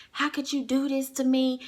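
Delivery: mildly hammed-up wounded complaint; going off. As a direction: imitate a wronged boyfriend's protest. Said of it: "just going off on me again".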